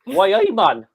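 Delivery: high, loud mock Geordie accent: "Why, hey, man?"; Geordie greeting. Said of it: "There you go".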